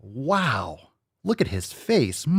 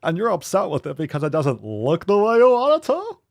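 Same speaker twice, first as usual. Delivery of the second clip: louder, higher copy of the smiling guy; imitating the video guy; 6 semitones higher, 5 dB louder than usual. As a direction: mimic his grinning voice. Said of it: "Did I get it".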